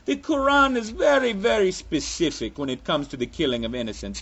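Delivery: put-on part Russian, part Jamaican, part Transylvanian vampire accent. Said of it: "but I'm gonna go with it".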